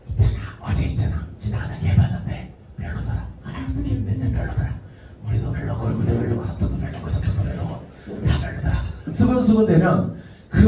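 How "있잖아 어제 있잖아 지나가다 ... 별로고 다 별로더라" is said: secretive whispered gossip; gossipy whisper. Retold or imitated. Students gossiping behind backs.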